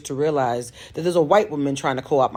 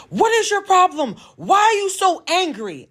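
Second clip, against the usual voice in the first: much higher and louder, a retold shriek; yelling on the phone; 4 dB louder than usual. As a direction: shriek the accusation back down the phone. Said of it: "she starts yelling".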